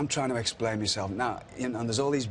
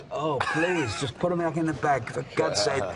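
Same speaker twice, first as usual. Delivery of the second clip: higher, pleading, embarrassed; exasperated plea; 5 semitones higher than usual. Retold or imitated. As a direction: beg them to stop, mortified.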